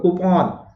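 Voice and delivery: "कूपन" as koo-PAWN, long o; model coupon. Shown as a demonstration. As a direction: say "कूपन" with a falling, open -pawn. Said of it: "बोल के देखिए सब लोग".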